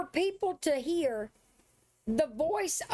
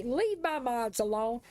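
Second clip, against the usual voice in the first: ordinary delivery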